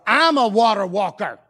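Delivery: loud, crowing declaration; boastful shout. Acted. Peter boasting to John about the water, boastful shout. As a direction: proclaim it loudly, bragging.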